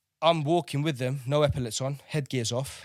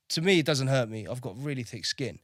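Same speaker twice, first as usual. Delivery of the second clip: usual speaking voice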